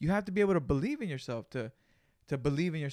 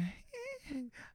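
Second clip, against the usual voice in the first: a high squeak of a laugh; real laughter, a sharp squeak; high squeaky laugh; 12 dB quieter than usual. Real, not acted. Cracking up at his friend, high squeak laugh. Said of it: "I have a squeak".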